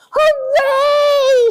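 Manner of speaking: put-on high, loud kids' hooray; children cheering. A narrator's reading. Children cheering as they get off the bus, loud and high. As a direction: cheer it like happy kids at home time. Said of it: "shout the children".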